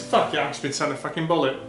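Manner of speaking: ordinary delivery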